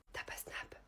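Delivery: hushed, voiceless pick-up line; whisper. Acted. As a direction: whisper the question to your crush. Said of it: "pourquoi tu chuchotes".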